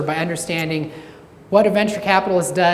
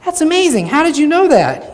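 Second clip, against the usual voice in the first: much higher, louder mock amazement; amazed; 11 semitones higher, 7 dB louder than usual. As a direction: gush like she can't believe it. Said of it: "And she's like".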